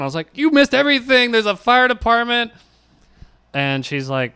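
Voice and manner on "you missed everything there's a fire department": excited. Retold or imitated. Telling roommate what she missed.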